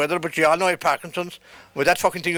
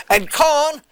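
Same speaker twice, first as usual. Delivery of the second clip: higher, louder yell from the sick wife, slowed; 6 semitones higher, 4 dB louder, slower than usual. Retold or imitated. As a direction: call down the corridor in her voice, loud and high. Sick wife yelling down the corridor.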